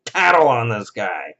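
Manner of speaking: mocking, slow, high tattletale voice; sneaky creeper. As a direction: play a creepy little snitch. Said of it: "some creeper who's gonna go".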